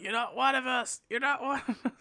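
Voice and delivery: hammed-up outcast accusation; Reg's line. Reg snarling at Julio, mock menacing. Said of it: "Reg comes like".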